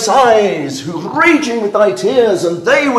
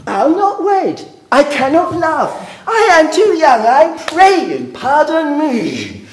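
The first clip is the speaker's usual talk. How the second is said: mocking whiny girl voice, higher; whiny daughter; 7 semitones higher than usual. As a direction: sneer as her whining. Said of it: "a whining puppet ... to answer".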